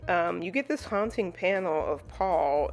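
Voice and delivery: normal talking voice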